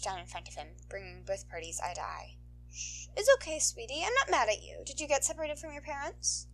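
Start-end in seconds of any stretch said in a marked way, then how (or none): 2.7-6.5 higher, gentle reassuring voice; calm the crying child; soothing heroine. Acted. Heroine crouching to comfort a lost boy, soothing.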